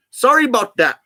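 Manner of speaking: Canadian "sorry" with a wide "about"; attempted British accent slipping into Canadian; mock Canadian accent. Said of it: "Sounds Canadian".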